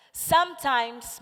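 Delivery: ordinary talk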